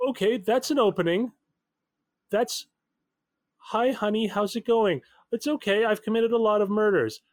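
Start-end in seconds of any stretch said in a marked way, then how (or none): 3.6-7.2 higher-pitched mock phone chat; lightly mocking, higher imitation; mock replay. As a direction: parrot the caller in a raised, incredulous voice. Mockingly replays the caller's opening.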